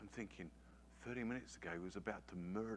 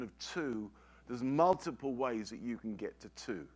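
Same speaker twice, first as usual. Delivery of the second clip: loud, high, fervent preacher's build; preaching at full force; 4 semitones higher, 8 dB louder than usual. Acted. Pastor hammering his point to the congregation, fervent and loud. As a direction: drive the point home from the pulpit.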